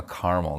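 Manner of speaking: caramel said as "CAR-mul"; two-syllable caramel. Shown as a demonstration. Picking a side in the debate, caramel as CAR-mul. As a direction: drop the middle vowel of caramel, CAR-mul. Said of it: "Caramel or caramel?".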